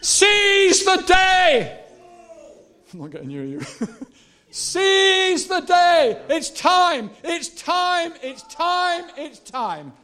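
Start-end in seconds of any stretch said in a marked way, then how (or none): 0.0-1.8 loud, very high, stretched shout; shouted exhortation. Real, not acted. Preacher doing this to the men, shouted exhortation. 4.5-9.9 high drawn-out shout easing into repeated calls; urgent exhortation. Real, not acted. Preacher urging the congregation that it's time, high urgent cry.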